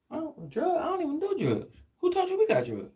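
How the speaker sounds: very high put-on voice; high-voiced mock protest; acted denial. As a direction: play innocent in a high pitch. Acted denial in drug exchange.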